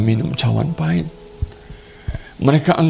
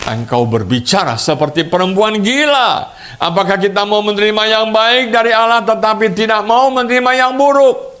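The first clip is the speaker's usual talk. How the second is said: Job pushes back with a firm challenge; Job rebuking his wife; 11 semitones higher than usual. Acted. Job answers his wife, an indignant high-pitched rebuke. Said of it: "Tapi Ayub menjawab kepadanya".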